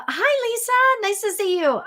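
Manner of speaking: very high, silly hello; goofy high-pitched welcome; playful greeting. Pretend. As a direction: greet her in a chirpy, put-on high voice. Playful greeting after funny voices.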